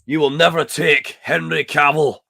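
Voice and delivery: loud, high, slow rallying shout; mock battle cry. Scot defending Henry Cavill, mock battle cry. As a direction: draw it out like a defiant war speech.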